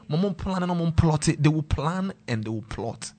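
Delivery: usual speaking voice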